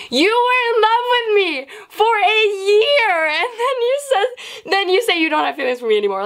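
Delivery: high-pitched indignant outburst, easing down; livid. Retold or imitated. Girl confronting the boy who changed his mind, livid. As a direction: protest in a squeal, then rattle it off. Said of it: "I was livid".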